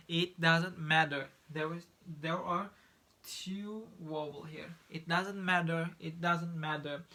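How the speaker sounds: matter said with a quick D, like madder; flap T in matter. Shown as a demonstration. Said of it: "or matter".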